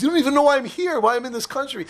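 high, upset protest; mock tearful. Crying patient to his helper, mildly distraught. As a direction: imitate a man wailing his trouble, lightly. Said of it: "The guy bursts out crying again".

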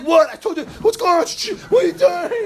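high, loud, incredulous yelling; outraged shout. Retold or imitated. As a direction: yell it in disbelief. Son outraged at his father.

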